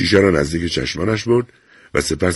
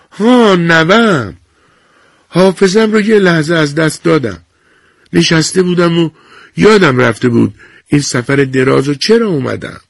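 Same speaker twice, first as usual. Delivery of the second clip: put-on old woman remembering; 11 semitones higher, 4 dB louder than usual. A narrator's reading.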